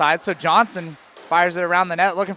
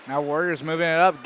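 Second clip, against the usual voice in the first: ordinary delivery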